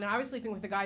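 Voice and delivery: usual speaking voice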